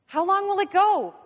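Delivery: loud, high questioning yell; shouted demand. Acted. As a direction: demand an answer from the underling. Inspector shouts questions from the ride.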